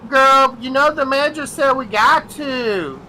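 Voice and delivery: high, drawn-out voice of a sassy coworker. Retold or imitated. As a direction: do the lazy McDonald's worker calling her friend.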